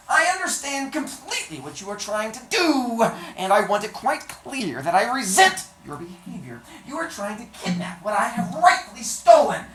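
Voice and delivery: high, loud indignant dwarf; hunchback dwarf voice. Acted. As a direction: scold him, high and haughty.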